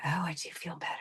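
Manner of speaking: hushed unvoiced whisper of relief. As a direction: breathe it out as a quiet discovery. Quoting realization after quitting alcohol.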